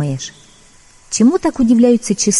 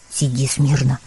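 sulky mutter; 8 semitones lower, 6 dB quieter than usual. Acted. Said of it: "Тут котёнок надулся".

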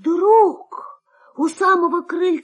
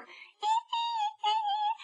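very high, scared mouse squeal; mouse squeak; 13 dB quieter than usual. Acted. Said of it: "запищал мышонок".

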